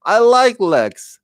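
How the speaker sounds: high, drawn-out lament; mock wail of disappointment; dismayed. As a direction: retell your dismay in a high, stretched whine. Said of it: "I was like".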